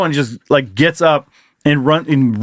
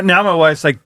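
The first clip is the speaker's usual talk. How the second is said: ordinary talk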